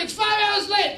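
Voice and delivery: high, loud exasperated complaint; American tourist yelling about a late ferry. Retold or imitated. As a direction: blurt it out losing your mind.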